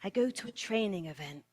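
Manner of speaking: usual speaking voice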